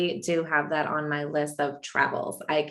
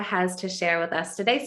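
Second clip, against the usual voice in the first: usual speaking voice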